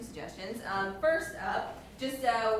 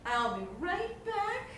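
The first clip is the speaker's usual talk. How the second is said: strained restraint; 7 semitones higher, slower than usual. Acted. Acted anger held back, leaving room.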